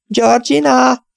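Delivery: high, slow, sing-song call of a name; fluting call. A narrator's reading. Old lady calling her cat in the garden, high fluting sing-song. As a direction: flute the cat's name out slowly. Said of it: "fuvolázta Mrs. Beck".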